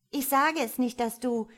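put-on child's high, loud taunting glee; jubelnd. A narrator's reading. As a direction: crow it teasingly at a brother. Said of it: "jubelte das Kind". (hoch und laut jubelndes Kind)

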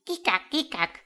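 high quacking chatter; duck chatter. A narrator's reading. Ducks chattering after the turkey, high and quacking. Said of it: "die Enten schnatterten".

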